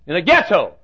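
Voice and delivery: sudden loud, high exclamation; preacher's emphatic cry; exclaimed. Acted. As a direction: punch it out in astonishment. Exclaimed sermon punchline.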